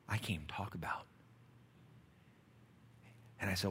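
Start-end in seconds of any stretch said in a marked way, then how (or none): 0.1-1.1 hushed whisper. Retold or imitated. Friend telling Todd about the lab, hushed whisper. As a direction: let it drop to a secret.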